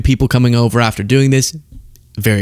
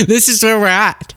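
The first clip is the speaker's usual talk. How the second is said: higher exasperated repeat; exasperated disbelief; 7 semitones higher than usual. Real, not acted.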